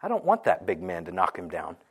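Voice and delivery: put-on very soft, low little-kid complaint; imitated two-year-old. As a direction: quote a toddler in a small, hushed voice. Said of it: "She looked at me, she said".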